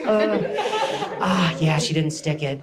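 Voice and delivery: lightly voiced skeptical sports announcer; doubtful announcer. Comedian mocks gymnastics announcers, lightly voiced doubtful tone. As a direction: sound unconvinced, like a gloomy commentator. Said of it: "That's what they'll say".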